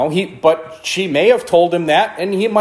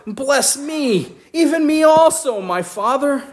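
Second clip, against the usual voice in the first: higher pleading, near tears; bitter cry; 8 semitones higher than usual. Acted. Esau begging his father for a blessing, higher tearful plea. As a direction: beg through tears. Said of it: "he cried with a great and exceeding bitter cry".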